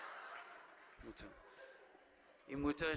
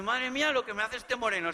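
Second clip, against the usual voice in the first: swooning fan; 7 semitones higher, 6 dB louder than usual. Shown as a demonstration. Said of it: "en plan de".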